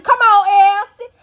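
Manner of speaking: loud, high, drawn-out cheering. Retold or imitated. Dolly cheering Elsie during the fight, loud and high. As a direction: yell her on like a wild fan. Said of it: "Dolly cheering on Elsie".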